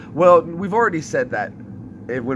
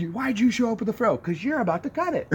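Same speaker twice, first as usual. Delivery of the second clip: retold drill-sergeant exchange; 9 semitones higher than usual.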